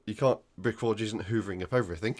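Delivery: ordinary talk, nothing marked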